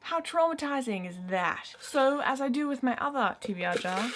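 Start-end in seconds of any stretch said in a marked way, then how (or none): none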